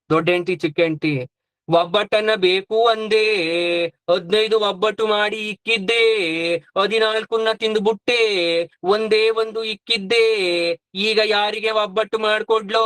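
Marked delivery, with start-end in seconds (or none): 1.7-12.9 sing-song lament on a level note; hammed-up widow's wail, each ending stretched long; mock wailing. Elder wife mourning over uneaten obbattu, mock wailing. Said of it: "ಬಡ್ಕೊಂತಾವ್ರೆ".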